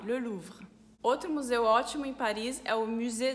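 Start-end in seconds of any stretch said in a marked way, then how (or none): 0.0-0.7 "Le Louvre" with the final R lightly said; soft final R. Shown as a demonstration. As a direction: say "Le Louvre" in French, letting the R fade. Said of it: "a gente fala mais suave". ("Le Louvre" com R final suave)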